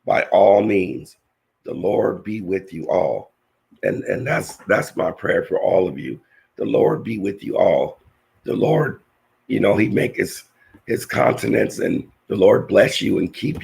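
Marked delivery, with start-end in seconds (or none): none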